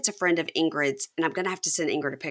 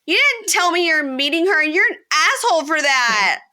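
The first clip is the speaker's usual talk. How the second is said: much higher, louder rebuke; mock scolding; 10 semitones higher, 6 dB louder than usual. Taylor to Chance, high angry rebuke. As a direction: scold him, hammed up. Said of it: "she goes".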